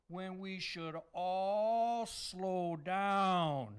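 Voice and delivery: very slow, stretched words; slowing right down. Pretend. Santa tells everyone to slow down, very slow delivery. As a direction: draw it out to make the point.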